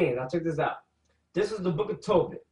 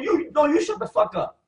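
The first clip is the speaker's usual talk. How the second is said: higher mock retort; wife snapping back at Tobit; talking back; 8 semitones higher than usual. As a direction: throw it right back. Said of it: "She told Tobit".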